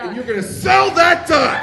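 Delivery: high, very loud callback; triumphant shout. Real, not acted. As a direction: shout it as the show's big finish. Host shouts the punchline to close.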